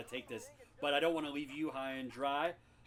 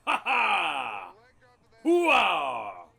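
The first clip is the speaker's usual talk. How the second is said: gruff bellowing army colonel; Frank Slade; 11 dB louder, much slower than usual. Acted. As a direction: roar it like a blind old colonel. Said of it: "Lieutenant Colonel Frank Slade".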